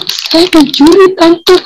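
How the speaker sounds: high, slow, half-crying plea; tearful protest. Retold or imitated. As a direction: plead through retold tears, drawn out. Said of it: "Saya nangis".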